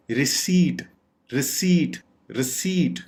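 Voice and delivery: "रिसीट" as ri-SEEET, silent p; stretched e. Shown as a demonstration. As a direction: draw out the ee in "रिसीट". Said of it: "खींचना है".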